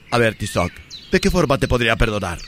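low stern voice. Acted. Voicing stern man in parody.

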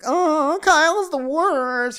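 very high, loud, slow put-on whine; mock whiny Amanda. Amanda complaining about Kyle.

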